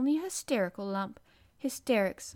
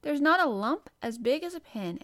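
raised, louder, emphatic denial; cross and childish; 6 semitones higher, 6 dB louder than usual. A narrator's reading. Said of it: "those crossly spoken, childish words".

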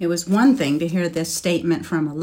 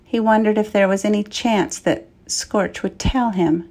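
usual speaking voice

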